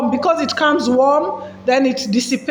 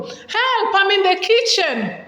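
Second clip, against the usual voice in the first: calling for help; 6 semitones higher, slower than usual. Shown as a demonstration. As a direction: call out high to someone in the next room. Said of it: "But if you say".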